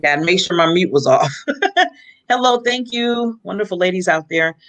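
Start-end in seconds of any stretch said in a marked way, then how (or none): none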